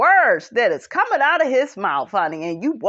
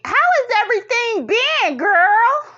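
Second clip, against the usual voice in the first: Tisha impression; 13 semitones higher, 4 dB louder than usual. Voices Tisha asking Destiny.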